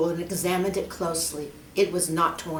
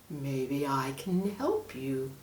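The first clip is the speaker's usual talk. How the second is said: lower, softer sinister snake; menacing snake; 4 semitones lower, 6 dB quieter, slower than usual. Acted. Snake hints at the monkey's fate, low. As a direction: let the threat hang quietly.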